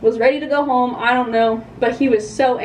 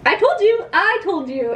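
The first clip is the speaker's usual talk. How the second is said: annoyed cop; 7 semitones higher than usual. Retold or imitated. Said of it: "He was not happy and was like".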